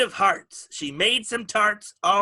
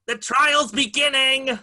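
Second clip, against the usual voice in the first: ordinary talk, nothing marked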